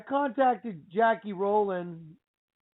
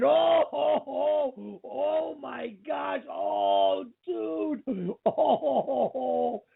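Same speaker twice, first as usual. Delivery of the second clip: drawn-out, higher "oh"s of disbelief; stunned movie reaction; 3 semitones higher, much slower than usual. Retold or imitated. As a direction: re-enact being blown away by a film, stretching the words. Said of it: "I was in here literally going".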